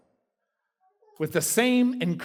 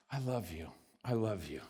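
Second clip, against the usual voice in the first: much softer and lower, loving whisper-close; tender God voice; 9 semitones lower, 12 dB quieter than usual. Retold or imitated. God cuddling a child, speaking soft and low. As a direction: cradle a child with words. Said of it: "and he says".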